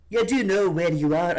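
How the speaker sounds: put-on loud, overdone Scottish accent; botched, too-loud Scottish attempt. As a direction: belt it out in a rough Scottish accent. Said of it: "I'm not gonna do a Scottish accent".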